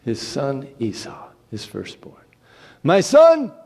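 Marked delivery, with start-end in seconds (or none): 2.8-3.5 high, loud call; an old blind father calling out to his son; old Isaac calling. Acted. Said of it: "He called to him his son Esau".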